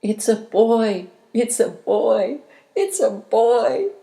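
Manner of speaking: joyful echo. A narrator's reading. The whole world echoes the news, loud. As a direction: let it ring out like a chorus. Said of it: "all the world echoed".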